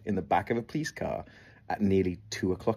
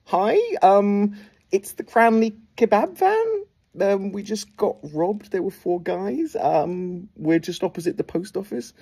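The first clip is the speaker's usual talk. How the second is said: put-on higher, slow, careful young voice reporting a robbery; nervous kid on phone; 10 semitones higher, 3 dB louder, slower than usual. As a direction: sound like a scared fifteen-year-old calling the police. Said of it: "and I'm like".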